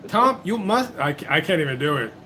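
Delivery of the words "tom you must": high-pitched, poorly faked African accent; botched African accent, pitched up; attempted African accent. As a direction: have a go at the wife's African accent. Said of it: "I can't really do African accents very well".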